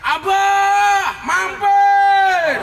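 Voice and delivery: high, loud, drawn-out hail; imitated calling out. Women by the road inviting the preacher to stop, shouted. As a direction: mimic women yelling to a passing car. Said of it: "mereka teriak".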